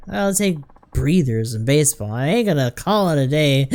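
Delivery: put-on indignant, slurring old ace; belligerent drunk. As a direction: bristle like an offended drunk.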